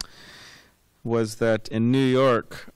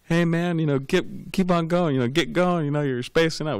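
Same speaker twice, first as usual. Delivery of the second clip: mock yell; 6 semitones higher than usual. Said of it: "he yells at him".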